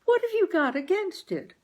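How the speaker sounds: coaxing mother. Acted. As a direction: wheedle your husband gently. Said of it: "asked my mother".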